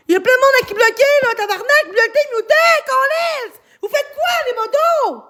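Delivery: high, strained scolding of the mods; high furious rant at the mods; angry yelling. Real, not acted. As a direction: build to an exasperated high yell.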